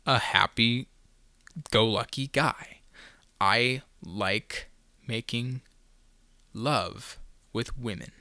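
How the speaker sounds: slow, soft mock seduction; mock-sultry. Podcaster joking to listeners about romance, slow mock-sultry. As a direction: draw it out softly with drawn-out pauses. Jokey slow confession about his love life.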